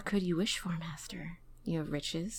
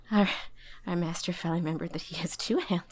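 ordinary delivery

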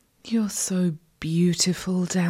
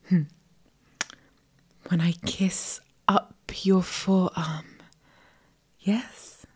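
ordinary talk, nothing marked

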